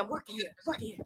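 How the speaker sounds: quiet aside. Real, not acted. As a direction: mumble to yourself about work. Muttered aside about work.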